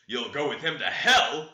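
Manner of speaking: loud, furious outburst; master's sudden furious shout; angry exclamation. A narrator's reading. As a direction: bark it at him in a rage. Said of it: "exclaimed his master".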